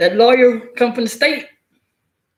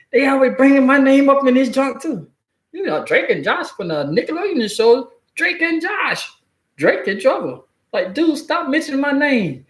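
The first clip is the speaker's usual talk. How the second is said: higher, fed-up celebrity voice; a hint of an annoyed star, higher; Josh; 7 semitones higher than usual. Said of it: "If I was Josh".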